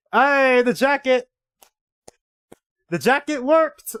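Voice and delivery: high, loud, thrilled; excited shout. Real, not acted. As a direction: cheer it out. Excited at jacket success.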